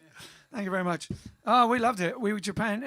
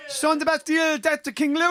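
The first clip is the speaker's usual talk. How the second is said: higher, louder rallying cry; mock revolutionary shout; 8 semitones higher, 7 dB louder than usual. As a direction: rouse the mob, half in jest. Chanting revolutionary slogan.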